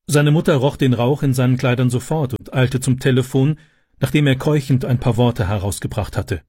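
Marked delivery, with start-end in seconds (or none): none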